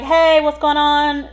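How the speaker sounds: high, loud, slow call to the patient. Retold or imitated. Nurse walking in on the patient, calling out. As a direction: call into the room. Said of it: "I'm like".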